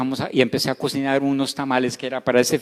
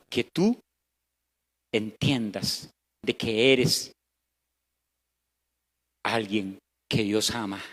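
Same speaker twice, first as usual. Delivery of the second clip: tender; 7 semitones lower, 5 dB quieter, slower than usual. Acted. Tender point in sermon.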